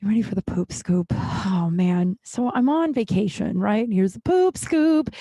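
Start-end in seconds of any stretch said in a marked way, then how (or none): 3.9-5.1 drawn-out, higher showy announcer call; slow, lifted game-show reveal; segment announcement. Acted. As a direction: sell the segment title like a jingle.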